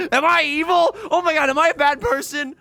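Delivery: high, loud giggling mock guilt; laughing at your own evilness. Real, not acted.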